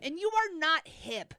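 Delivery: high-pitched insistent put-down; real squabbling contradiction; bickering retort. Real, not acted. Bickering over who is hip.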